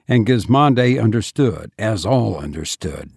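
usual speaking voice

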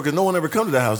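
ordinary talk

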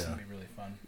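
hushed, almost whispered; very quiet. Shown as a demonstration. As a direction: keep it barely audible. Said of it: "They would just barely hear it".

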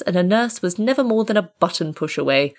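ordinary delivery, nothing marked

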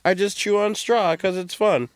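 high-pitched mock of a carefree hipster; hipster vibe. Mocking a musician's hipster vibe to the co-host, high-pitched imitation. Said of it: "He was just like".

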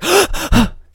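loud, high mock-panicked gasps; imitated gasps. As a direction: act out frightened gasps from behind a door. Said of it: "I went, and I hear".

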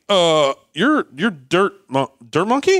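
hesitant, high-pitched questioning; acted bewilderment. Acts out meeting a visitor, baffled.

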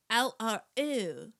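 very slow, drawn-out mock Cockney; exaggerated Cockney accent drill. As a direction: stretch each sound in a hammed-up Cockney. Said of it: "the Cockney accent".

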